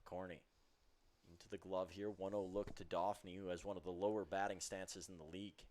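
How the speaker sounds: ordinary talk, nothing marked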